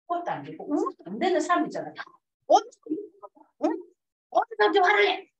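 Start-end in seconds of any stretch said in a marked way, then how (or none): 2.5-3.8 mock scolding elder. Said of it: "약간 꼰대 말". 4.3-5.3 mock scolding elder. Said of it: "약간 꼰대 말".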